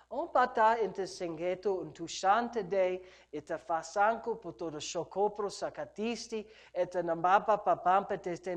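low stream of repeated syllables; rhythmic, unbroken glossolalia, softened; praying in tongues. Real, not acted. Preacher prays in tongues before the congregation, low rhythmic glossolalia.

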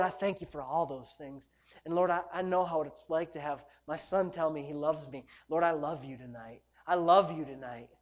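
low, softened praying voice; intimate prayer. Real, not acted. Preacher tells the Lord he loves Him, low intimate prayer. Prays aloud to God.

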